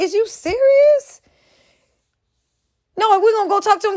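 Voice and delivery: real outraged incredulity; incredulous. Real, not acted. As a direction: stretch it out in disbelief. Incredulous at his claims.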